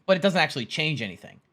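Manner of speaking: usual speaking voice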